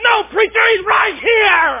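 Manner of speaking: high, loud, very slow insisting; insistent excitement. Retold or imitated. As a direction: stretch out each word in awe. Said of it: "He said".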